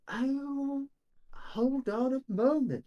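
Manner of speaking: lightly voiced character, high drawn-out delivery; curious shopkeeper. Game master voices shopkeeper character.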